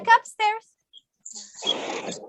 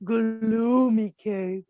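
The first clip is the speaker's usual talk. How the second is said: low, slow spooky story voice; storytelling hush; 11 semitones lower, slower than usual. Acted. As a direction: draw the children into the cave. Leads bear hunt story with kids.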